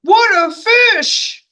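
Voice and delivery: high, slow amazed policeman; policeman's amazed exclaim. Acted. Policeman staring at the huge fish, slow amazed cry. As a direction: gasp it out in wonder at the fish. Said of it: "he said".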